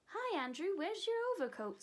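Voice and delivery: high, airy voice mimicking a fussy lady; high windy lady voice. Boy mocking the lady next door, high and windy. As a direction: lift it up high and breathy like Miss Lark. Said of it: "trying to make a high, windy voice like Miss Lark's".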